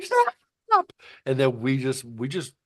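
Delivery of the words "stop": very high, loud shrieked stop; a frantic high cry of stop; toddler yelling stop. Retold or imitated. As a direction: scream it like a fed-up two-year-old. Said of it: "And then she's like".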